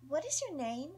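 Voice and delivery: high, cute rising question; playful little girl. Acted. Young Nita asking a stranger's name again, playful. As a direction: ask it again, sweet and curious. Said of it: "sound curious, playful and cute".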